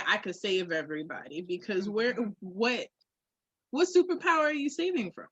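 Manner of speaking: usual speaking voice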